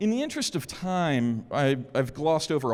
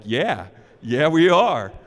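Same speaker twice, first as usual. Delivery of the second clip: loud gleeful reply; 8 dB louder, slower than usual. Retold or imitated. Client confirms catching the attacker.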